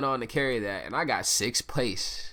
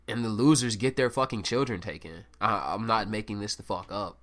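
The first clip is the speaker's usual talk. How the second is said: normal talking voice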